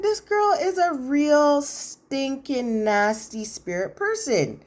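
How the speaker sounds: slow, high, drawn-out disgust; appalled condemnation. Real, not acted. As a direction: stretch out every damning word in disbelief. Narrator condemns the wife's behaviour.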